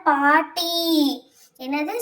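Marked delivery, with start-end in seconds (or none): 0.1-0.7 ghost grandmother voice. Acted. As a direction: announce yourself as the spirit of the old grandmother. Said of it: "ஸ்வீட்டியோட".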